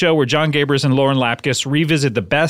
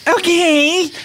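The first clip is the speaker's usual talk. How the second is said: high squeaky; 14 semitones higher than usual. Pretend. Very high okay.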